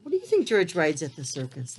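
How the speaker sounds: ordinary delivery, nothing marked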